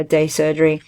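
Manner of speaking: ordinary talk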